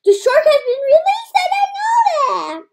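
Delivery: high laughing shout; loud, high laughing announcement. Real, not acted. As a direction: blurt it out while laughing.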